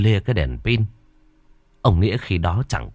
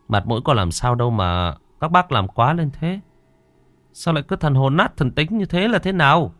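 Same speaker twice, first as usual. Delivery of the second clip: exasperated chiding; 6 semitones higher than usual. Acted. Brother-in-law chides the frightened men.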